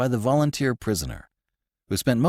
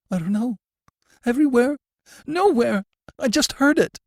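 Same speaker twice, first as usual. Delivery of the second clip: high, loud, frantic answers; panicked and near tears; sobbing prisoner describing the sound; tearful terror; 5 dB louder than usual. A narrator's reading. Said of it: "He was sobbing".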